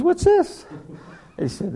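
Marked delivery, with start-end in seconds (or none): 0.0-0.7 high, squeaky mock disbelief; incredulous high voice. Preacher voicing a scoffing pilot, squeaky and incredulous. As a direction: pitch it way up like a scoffing old friend.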